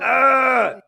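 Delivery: long, high, loud scream; loud wail of revulsion; anguished scream. Real, not acted. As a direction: scream to drown out the clip. Reacting in horror to the clip.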